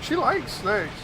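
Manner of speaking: normal talking voice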